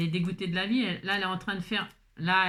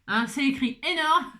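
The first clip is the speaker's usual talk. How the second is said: laughing; 4 semitones higher, 5 dB louder than usual. Real, not acted. Laughs at the oversized text.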